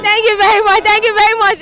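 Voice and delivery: loud, high, enthusiastic thanks; excited thanks. Real, not acted. Host thanking a guest for answering in dialect, excited.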